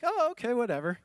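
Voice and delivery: breezy high mock nonchalance; airy, unimpressed "oh, okay"; dismissive shrug. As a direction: toss it off like it's nothing. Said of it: "you're probably not like".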